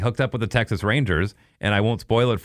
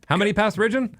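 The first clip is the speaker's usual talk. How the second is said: mock teasing voice; 14 semitones higher than usual. Teasing cohost in a put-on voice.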